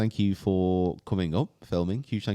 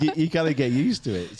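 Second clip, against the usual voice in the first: ordinary talk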